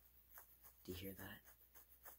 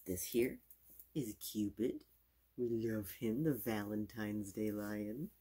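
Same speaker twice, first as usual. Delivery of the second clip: loud excited; 9 dB louder, slower than usual. Acted. Loud showing of a plush.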